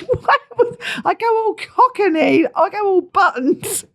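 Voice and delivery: high, slow words through laughter; laughing hard. Real, not acted. Explaining the failed Cockney to co-host, laughing hard. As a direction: struggle to speak while cracking up.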